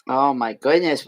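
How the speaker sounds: ordinary delivery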